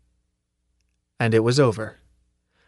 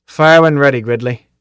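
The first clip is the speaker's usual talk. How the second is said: mock order; 3 semitones higher, 8 dB louder than usual. Sergeant signals fight start.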